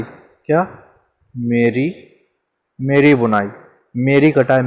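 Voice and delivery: ordinary talk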